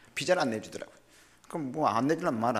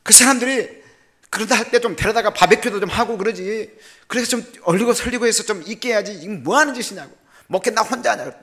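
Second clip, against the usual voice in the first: loud, high retold shout at his wife; a husband venting his frustration, shouting; yelling at wife; 8 semitones higher, 8 dB louder than usual. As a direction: scold her loudly, voice pitched up. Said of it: "막 소리를 질렀습니다".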